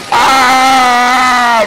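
loud high battle yell; battle shout. Real, not acted. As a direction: roar it out at the zombies. Fighting zombies, battle cry.

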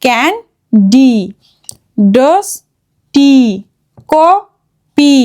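ক্যান্ডি, ডাস্টি, কপি split slowly into syllables; long final E. Shown as a demonstration. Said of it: "long এ sound করে". (ক্যান্ডি, ডাস্টি, কপি-র শেষে টানা ই)

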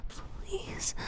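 soft begging whisper; pleading whisper. Acted. Pleading whisper to partner in hiding.

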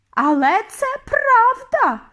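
loud, high, drawn-out insistence; high witch's emphatic protest; insisting. A narrator's reading. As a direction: insist at the top of the voice. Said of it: "наполягала висока відьма".

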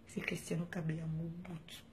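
soft whisper, fading away. Acted. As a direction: whisper your own name like a secret. Whispered sign-off to himself. (murmuré tout bas)

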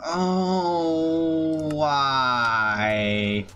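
long, sustained wail sliding downward; drawn-out lament. Real, not acted. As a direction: stretch both words into one falling moan. Lamenting a loss in game.

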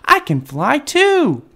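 high, stretched-out proud cheer; delighted high little voice; excited baby bat. A narrator's reading. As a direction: declare it slowly with childlike glee. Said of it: "thought Stellaluna".

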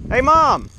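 high, loud call across the shore; bright loud hail. Real, not acted. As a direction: call out to Mom from a distance. Calling out to his mom.

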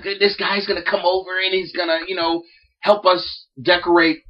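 put-on breezy teen-girl chatter. The daughter's friend announcing the boy, lightly voiced.

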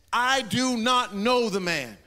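loud, slow, emphatic denial; adamant denial. Retold or imitated. Peter denying Jesus to the crowd, loud and slow. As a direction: hammer each word, denying him. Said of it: "He gets so adamant".

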